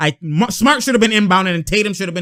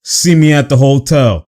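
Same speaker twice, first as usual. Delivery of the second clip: loud, lower-pitched mock challenge; imitated threat; 4 semitones lower, 7 dB louder than usual. Imitating Smart threatening a rival, loud challenge. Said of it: "Smart's like".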